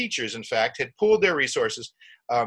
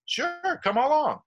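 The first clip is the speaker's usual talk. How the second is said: much higher, sing-out voice; imitated breezy agreement; 13 semitones higher, slower than usual. Crew welcoming the newcomer aboard, high-pitched mock agreement. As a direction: imitate an easy yes, pitched way up.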